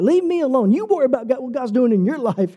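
high, testy brush-off. Retold or imitated. Preacher recalling replies to people nudging him, testy. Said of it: "I get a little bit testy".

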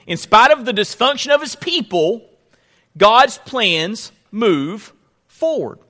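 very slow, drawn-out proclamation; booming motivational speech. Acted. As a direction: stretch every word for the congregation. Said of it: "let me say it like this".

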